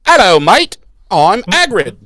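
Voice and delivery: high, loud mocking Hagrid impression; Nick Frost as Hagrid. Podcaster mocking an actor's take on Hagrid, loud hammed-up impression. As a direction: belt out a hammed-up film-character greeting. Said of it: "And now he's like".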